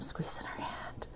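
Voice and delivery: nervous whisper. Real, not acted. About to confess to her parents, whispering. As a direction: breathe the words out scared.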